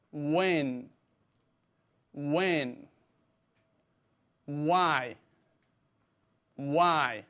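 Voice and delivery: each word, "What" to "Why", alone with a falling tune; pronunciation drill. Shown as a demonstration. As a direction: model "What", "Where", "How", "When", "Why" for repeating. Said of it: "Repite conmigo".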